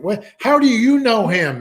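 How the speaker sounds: high, loud, urgent retold question; shocked, almost crying. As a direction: imitate a jarred man suddenly overcome and demanding an answer. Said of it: "went from laughing to almost crying".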